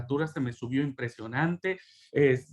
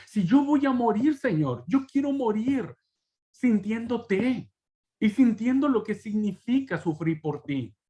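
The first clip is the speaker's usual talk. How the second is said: raised, pleading cry to God, slowing on sintiéndote; fervent prayer; 6 semitones higher than usual. Retold or imitated. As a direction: plead with God, voice lifted high. Retold fervent prayer in crisis.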